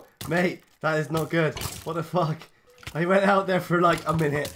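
loud, shocked outburst; loud panic. Real, not acted. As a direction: blurt it out loud in alarm. Panicked after explosion.

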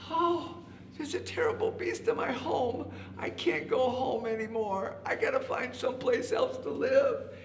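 high, woeful rabbit voice; forlorn rabbit. A narrator's reading. Rabbit giving up his home, high forlorn voice. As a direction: lament it in a high voice. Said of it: "The rabbit said".

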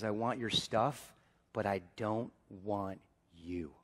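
said low and soft, almost under the breath; hushed son's demand. Shown as a demonstration. Preacher voicing the son to the church, low and hushed. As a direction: lean in and drop the voice to a near hush. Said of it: "Do you guys hear me?".

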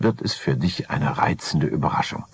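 ordinary delivery, nothing marked

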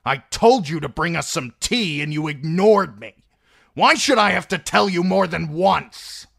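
angry outcry. Acted. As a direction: blurt it out in a humiliating burst of rage at Browd. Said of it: "humiliated by her outcry".

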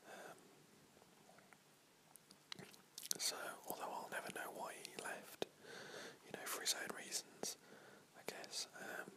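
unvoiced-breath whisper, unhurried, with long pauses; ASMR whisper. Real, not acted. Whisper channel host catching up with listeners after a long break, whispering. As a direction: whisper it like a relaxed, chatty ASMR video.

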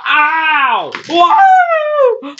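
high yelp into very high whoop; mock falling cry. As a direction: cry out like a wrestler tossed out. Wrestler thrown out in play match.

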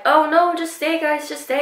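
high-pitched imitated plea; pleading. Retold man begging them to stay.

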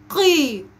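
قي drawn out with an EE after a back qaf; qaf with kasra. Shown as a demonstration. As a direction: model a sustained قي. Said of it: "وبالكسر". (قاف مكسورة ممدودة)

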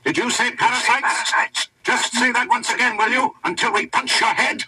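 high, blaring challenge; a hint of a trumpeting Teuton; Teuton through a trumpet. A narrator's reading. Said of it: "like a Teuton's speaking through a trumpet".

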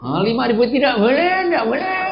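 high, loud bargaining seller's voice; haggling Jogja seller. Retold or imitated. A preacher playing a stubborn Jogja seller, high and loud. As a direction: put on a stubborn market trader turning down the price.

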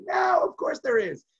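high, slow shout of "No"; the whole table shouting back the obvious; group protest. Acted. Everyone at the seder table shouting down the rhetorical claim.

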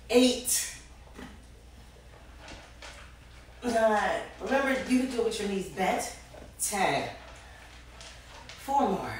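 counting through the strain of the workout; straining mid-exercise. Real, not acted. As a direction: push the count out while straining. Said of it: "holding your breath like me".

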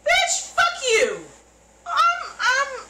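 high, slow, loud angry yell; furious shout. Real, not acted. Viewer yelling at the principal, furious shout. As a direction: scream it at the screen.

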